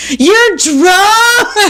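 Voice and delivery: high screaming-kid imitation; hammed-up shriek, breaking into laughter; mock scream. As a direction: scream it like an accusing child.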